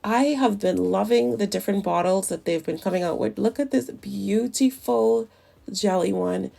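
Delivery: ordinary delivery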